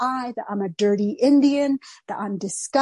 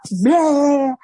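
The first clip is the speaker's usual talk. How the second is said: loud, higher spewed-out noise; blurted bleh; 4 semitones higher, 7 dB louder than usual. Retold or imitated. Telling the therapist her story, spewed out as noise. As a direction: spill it all out in one long bleh.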